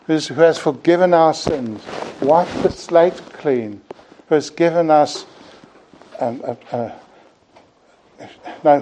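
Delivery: impassioned preaching climax; rising preaching fervor. Acted. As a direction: preach it with mounting conviction.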